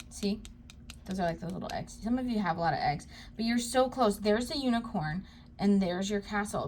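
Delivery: ordinary delivery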